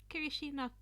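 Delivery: usual speaking voice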